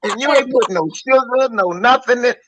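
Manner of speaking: mock scolding. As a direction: scold them for calling cobbler dumplings. Acts out scolding a cook.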